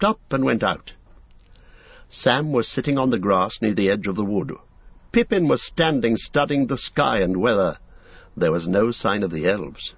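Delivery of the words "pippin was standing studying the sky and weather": usual speaking voice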